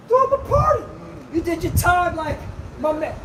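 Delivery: high-pitched incredulous mimicry; mock celebration. Mocking church elders welcoming offenders back, high and exaggerated.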